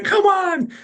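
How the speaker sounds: loud, very high falsetto exclamation; exasperated squeaky impression; high-voiced mentor. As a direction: cry it out in his high-pitched voice. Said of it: "He's got a really high voice".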